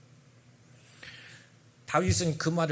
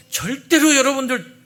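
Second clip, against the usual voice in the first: loud, high call; loud pulpit warning; emphatic exhortation; 7 semitones higher, 12 dB louder than usual. Real, not acted. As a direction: warn the congregation hard.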